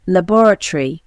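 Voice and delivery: British accent "Laboratory" as la-BOR-a-tree; British accent: stress BOR in "Laboratory"; British accent demo. Shown as a demonstration. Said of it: "Pronunciation of particular words".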